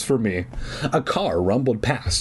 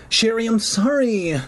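high, pleading apology; apologetic; 10 semitones higher than usual. A narrator's reading. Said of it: "I apologized".

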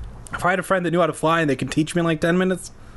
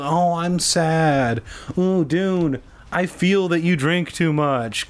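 higher, mock-sad whine; mocking girls' feelings; 4 semitones higher, slower than usual. Host mocking girls sharing feelings, fake sad. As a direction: mockingly put on hurt feelings. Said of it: "girls and their feelings".

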